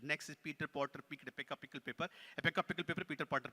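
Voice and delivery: ordinary talk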